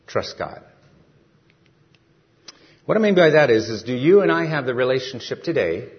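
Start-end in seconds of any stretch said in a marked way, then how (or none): 2.8-5.9 raised preaching voice. Real, not acted.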